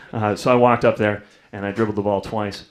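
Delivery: ordinary talk, nothing marked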